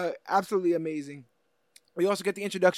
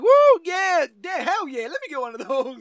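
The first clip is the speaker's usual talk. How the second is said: loud, higher gleeful whoop; excited whoop; 8 semitones higher, 5 dB louder than usual. Retold or imitated. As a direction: cheer like a kid with a new toy. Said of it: "He's like".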